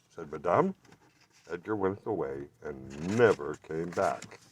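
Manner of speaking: ordinary delivery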